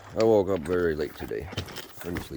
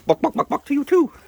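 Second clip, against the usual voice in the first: very high, louder hen clucks; mock clucking; 6 dB louder than usual. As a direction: cluck back at the bird teasingly. Clucking back at a bird.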